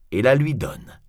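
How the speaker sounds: ordinary delivery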